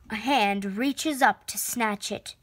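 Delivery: ordinary delivery, nothing marked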